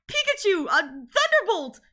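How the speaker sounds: high and slow, a pleading order; Ash commanding. Retold or imitated. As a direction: call the attack, stretching it out. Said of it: "And, and Ash is like".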